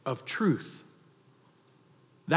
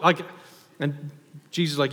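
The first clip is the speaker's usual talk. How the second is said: ordinary talk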